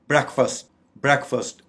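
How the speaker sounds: "ब्रेकफास्ट" said BREK-fust, both vowels short; correct pronunciation. Shown as a demonstration. Teacher modelling the correct word, "ब्रेकफास्ट" as BREK-fust. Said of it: "इसकी दुरुस्त pronunciation है".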